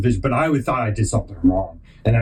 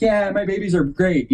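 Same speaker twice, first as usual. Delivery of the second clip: higher mock-gushing parent voice; imitated proud parent; 7 semitones higher than usual. Every parent bragging about the kids, lightly voiced mock gushing. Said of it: "every parent's like".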